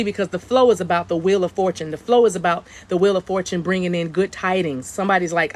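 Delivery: ordinary talk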